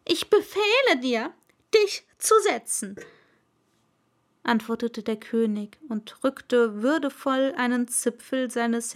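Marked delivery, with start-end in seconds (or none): 0.1-2.9 high, loud royal order; pompous king's decree; commanding king. Acted. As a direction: forbid it with full authority. King grants seat.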